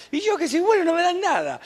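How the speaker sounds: high, whiny put-on son's complaint; imitating his son. Pastor imitating his son complaining about God, high whiny voice. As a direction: mimic a sulky son who feels cheated. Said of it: "como decía un hijo mío".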